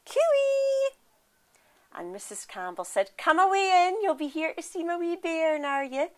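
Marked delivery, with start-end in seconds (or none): 0.1-1.0 high sing-song call, louder; old women calling a singsong cooey into the house. Acted. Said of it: "So they shouted". 3.2-6.1 lightly voiced Scottish mother, higher; a hint of a proud new mum; mother welcoming the old women in, lightly voiced. Mrs Campbell welcomes the visitors.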